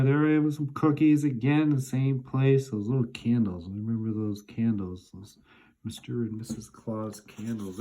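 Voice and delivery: normal talking voice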